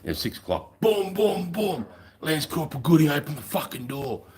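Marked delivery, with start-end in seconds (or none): 0.8-1.9 high, pitched-up booms like fists on a door; imitated door banging. 2.2-4.2 high, put-on military police voice; MP yelling. MP shouting through door.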